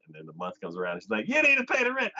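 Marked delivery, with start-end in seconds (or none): 1.3-2.1 high, loud rent demand; demanding landlord. Retold or imitated. Landlord demanding rent from the tenant, loud and high. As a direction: call out the rent due sharply.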